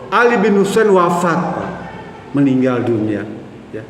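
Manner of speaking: normal talking voice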